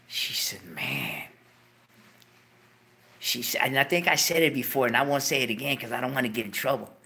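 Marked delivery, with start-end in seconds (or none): 0.6-1.2 low breathy whisper; grieving friend, whispered. Retold or imitated. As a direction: whisper it grimly. Grim sigh of friend.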